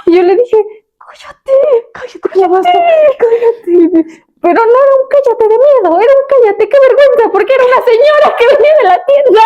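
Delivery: high, squeaky cartoon pumpkin voice. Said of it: "tienes que hacer voz de calabaza".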